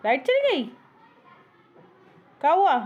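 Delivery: high cooing pet talk; sing-song to a pet. Pretend. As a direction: coo at the bird as if it were a baby. Soothing the parrot when the power cuts.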